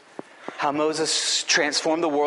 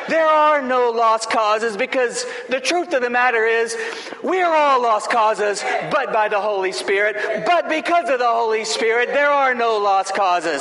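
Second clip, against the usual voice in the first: fiery preaching; 6 semitones higher, 5 dB louder than usual. Acted. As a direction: proclaim it to the whole church. Preaching climax, loud and slow.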